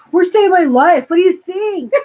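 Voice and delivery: imitated indignant teen rant; in-your-face Napoleon. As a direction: throw it straight in grandma's face, loudly. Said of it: "straight up in her face goes".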